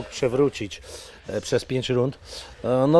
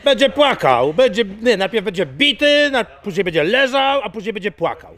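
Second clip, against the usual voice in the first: mocking trash talk; 9 semitones higher than usual. Trash talk about rival.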